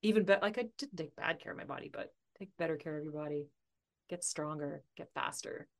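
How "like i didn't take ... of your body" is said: usual speaking voice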